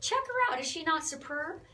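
high, gleeful show-off; delighted high-pitched hype; excited bragging. Real, not acted. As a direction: proudly announce her, pitch soaring. Showing off Mom's old singing group.

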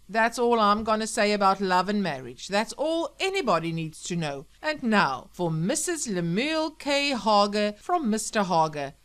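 loud radio DJ announcing a dedication; radio host Sam, heartfelt dedication. Acted. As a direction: announce it grandly to the listeners.

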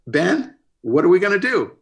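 high, loud, helpless plea; retold panicked question; mock panic. Retold appeal to producer in crisis.

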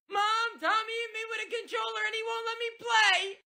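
put-on high, fast whining boy calling for mom; whiny tattling kid.

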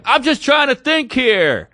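high, strained, slow protest; exasperated outburst. Retold or imitated. Man pleading with noisy girls on a bus, exasperated outburst. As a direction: imitate a man at the end of his patience.

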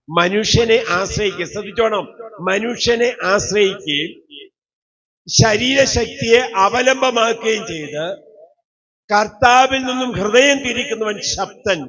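preacher warning the congregation with the verse; preaching scripture. Acted. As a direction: drive each word of the curse home.

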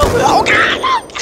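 sudden shrieking high yell; startled scream. Real, not acted. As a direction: jolt and scream in fright.

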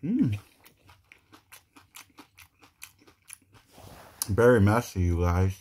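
talking between bites; muffled by food in mouth; mouth full, chewing. Real, not acted. Seen 0: Bites taco just before; closed mouth and chewing through the words.

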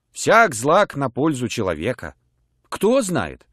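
ordinary delivery

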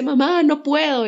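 mock-weepy child complaining to mom; whiny crying child. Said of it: "llega llorando y me dice". (niña llorona imitada, voz más aguda)

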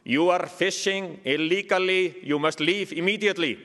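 mock-official order with a heavy Icelandic accent. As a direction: recite a stiff warning in a thick Icelandic accent. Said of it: "and in Icelandic accent".

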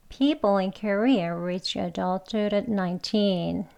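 every word of the sentence slowly and evenly spaced; slow careful reading. Shown as a demonstration. Teacher reading an example sentence slowly for learners. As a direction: read it out very slowly for the class. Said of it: "한 번 더요".

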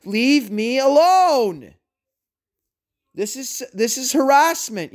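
building from steady to furious; calm turning angry. Real, not acted. Rant at harassers building to fury.

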